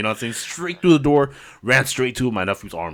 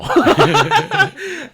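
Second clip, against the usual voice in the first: a high, squealing laugh; high burst of laughter; 4 dB louder than usual. Real, not acted. Laughing at own joke.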